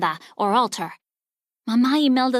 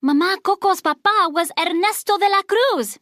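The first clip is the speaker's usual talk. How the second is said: put-on higher, louder boyish exclamation; thrilled boy's outburst; excited cry; 7 semitones higher, 3 dB louder than usual. A narrator's reading. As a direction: blurt out the discovery in wonder. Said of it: "Miguel cried".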